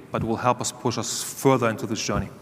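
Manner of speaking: ordinary delivery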